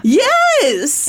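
very high, exasperated yes; emphatic yes. Real, not acted. Embarrassed storyteller admitting everyone laughed, emphatic yes. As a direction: squeal out the admission.

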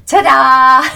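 loud, drawn-out high ta-da; celebratory ta-da. Acted. Coach showing off what she got done today, triumphant flourish. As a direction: sing out a proud flourish.

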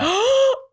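sharp high gasp of fake shock; theatrical gasp; mock gasp. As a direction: gasp as if scandalised. Mock outrage at his claim.

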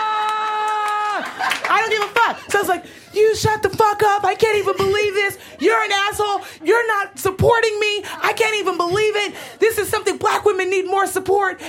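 shouting back at the man mocking her, livid. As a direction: let it all out in a livid rant. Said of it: "I'm just livid".

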